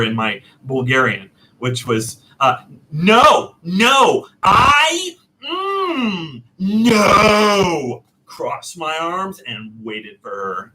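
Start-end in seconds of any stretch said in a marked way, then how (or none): 2.9-8.0 high, loud, wailing no; stubborn shouting refusal. Retold or imitated. Customer refusing the clerk, with a loud, high, whining no. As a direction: refuse her outright, almost crying it. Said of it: "the English version first of how I talked to her".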